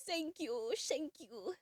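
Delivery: usual speaking voice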